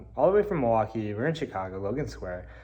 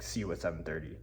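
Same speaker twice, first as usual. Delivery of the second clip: low menacing owner; 6 semitones lower, 10 dB quieter, faster than usual. Retold or imitated. Owner eyeing him down.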